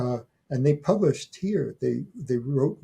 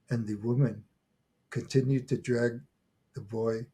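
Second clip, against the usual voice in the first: normal talking voice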